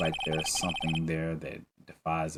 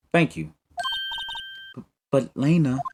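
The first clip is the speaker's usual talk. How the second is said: tearful little sister; 10 semitones higher, 4 dB louder than usual. Acted. Voicing characters in tense scene.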